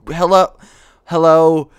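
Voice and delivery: loud hello; calling out. Pretend. Calls out loudly mid-play.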